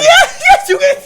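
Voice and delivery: laughing. Real, not acted. Laughing after tickling bit.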